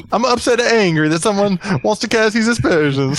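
high, hammed-up Italian accent; bad Italian accent. Shown as a demonstration. Reading the runner's angry quote in a bad Italian accent. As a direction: ham it up as a comic Italian. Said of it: "I can do the Italian, the, the bad Italian accent".

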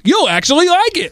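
mock-surprised show motto, pitched very high; exaggerated astonishment, like a tagline nobody believes. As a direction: imitate a listener's amazed approval. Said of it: "so much surprise in your voice".

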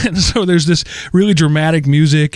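ordinary talk

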